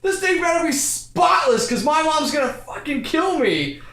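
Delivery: high, worked-up mock yell; imitated angry yelling. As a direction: yell it like a panicked, angry friend. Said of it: "really yelling at me".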